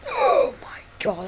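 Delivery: breathy awed whisper, drawn out; horrified hushed oh my god; whispered shock. Real, not acted. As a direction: gasp it under your breath. Shocked gasp at gory scene.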